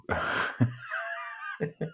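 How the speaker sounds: airy burst of laughter; breathy laugh. Real, not acted. Laughs at own misreading.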